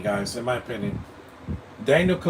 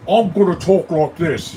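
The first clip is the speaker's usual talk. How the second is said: loud, slow, higher British caricature; mock British accent; 5 semitones higher, 9 dB louder than usual. Reviewer showing how the accent doesn't fit, loud mock British accent. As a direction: drag out an exaggerated British accent at full volume. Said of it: "his accent doesn't fit in this movie".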